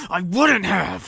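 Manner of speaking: angry retort. Acted. Bickering argument, snapping back.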